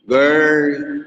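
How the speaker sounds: गर्ल with a hard Irish r. Shown as a demonstration. Teacher demonstrating the Irish accent. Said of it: "वो इस तरीके से बोलते हैं".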